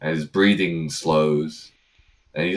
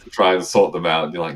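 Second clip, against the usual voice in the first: normal talking voice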